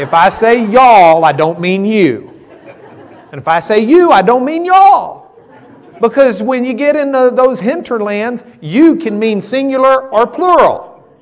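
loud, high preaching voice; full-force preaching. Acted. Pastor preaching to his class on y'all, loud and high. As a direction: preach it out to the whole room.

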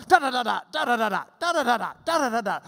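quick DA bursts, stepping down from high; rat-a-tat dictation. Acted. Teacher acts Paul mid-dictation, rapid rat-a-tat. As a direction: keep the patter racing along. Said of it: "see Paul dictating this".

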